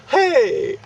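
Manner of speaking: high, loud "hey" of protest; retold outraged shout; indignant mimicked yell. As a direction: yell it like someone just got smacked. Mimics Gary being hit.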